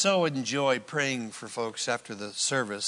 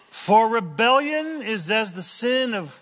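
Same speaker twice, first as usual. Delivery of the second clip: forceful scripture reading; 8 semitones higher, 6 dB louder than usual. Acted. Preacher reads the verse with force.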